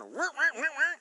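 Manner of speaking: high, loud motorcycle whir; imitated engine revving. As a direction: mimic the bike revving away.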